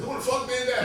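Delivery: hammed-up angry demand in the limo; mock outrage. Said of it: "I went off in the limo".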